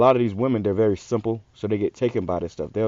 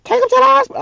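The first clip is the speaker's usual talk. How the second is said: much higher, louder snapped order; snapping mother; 7 dB louder, faster than usual. Retold or imitated. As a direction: bark it like a panicked mom. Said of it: "His mom snapped him".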